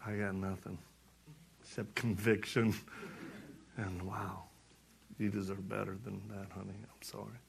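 soft, low retold apology; hushed, convicted husband; humbled apology. Retelling his humbled apology to wife.

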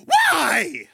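high-pitched mock child's whine; pestering little kid. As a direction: squeal it like a nagging three-year-old. Three-year-old keeps asking why.

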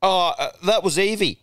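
a hint of a four-year-old passing the blame; little boy excuse. Four-year-old son blaming his sister, light kid voice. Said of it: "And he's going".

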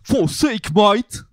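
shouted, high mock British accent; exasperated caricature British shout; English accent. Host mocking English speech, loud British accent. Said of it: "avec l'accent anglais".

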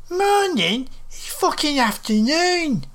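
high-pitched indignant echo, rising in disbelief; livid. A narrator's reading. Old woman livid at being greeted with morning, indignant. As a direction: throw the greeting back in high outrage. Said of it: "She was livid".

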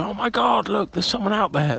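high, startled "oh my God, look"; mock alarm. Shown as a demonstration. [name] coaching Roger to fake spotting a burglar, high mock alarm. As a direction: fake a sudden fright for Claire. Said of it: "just go".

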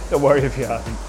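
ordinary talk

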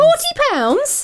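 high-pitched, drawn-out incredulous echo; outraged disbelief. Real, not acted. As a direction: question the price in disbelief, pitch soaring. Outraged echo of the taxi price.

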